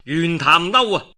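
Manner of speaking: plain reading voice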